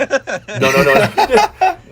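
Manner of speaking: high laughing protest; laughing while protesting. Real, not acted.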